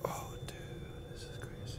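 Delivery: breathless stoned whisper. Real, not acted. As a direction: whisper it in awe. Stoned, awed aside.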